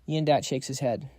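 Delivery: ordinary delivery, nothing marked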